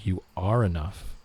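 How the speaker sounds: slow, with ARE stretched out as the key word; stress on ARE. Shown as a demonstration. Streamer showing a viewer second-word emphasis, stress on ARE. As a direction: lean on the middle word ARE. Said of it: "Put the emphasis on the second word".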